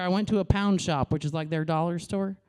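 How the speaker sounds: ordinary talk, nothing marked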